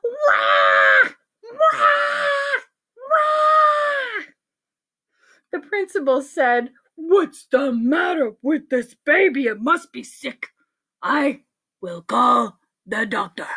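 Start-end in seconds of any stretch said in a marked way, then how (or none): 0.0-4.2 very high piercing mock wails; screaming baby. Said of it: "It screamed really loud". 6.9-13.5 far-from-natural official voice, slowing at the end; exasperated principal. Acted. Principal decides to call doctor.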